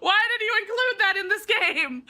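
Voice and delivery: half-laughing, half-crying complaint. Real, not acted. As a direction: complain through helpless laughter. Laughing in exasperation.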